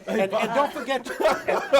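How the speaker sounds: laughing through the words; chuckling while talking. Real, not acted. Host laughs as Bob eats props.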